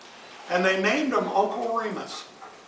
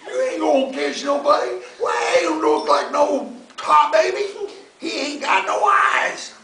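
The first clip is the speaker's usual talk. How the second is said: louder, higher mocking bear voice; Brer Bear scoffing; 8 semitones higher, 7 dB louder than usual. Bear ridiculing the fox's tar baby, loud scoffing. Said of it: "says Brer Bear".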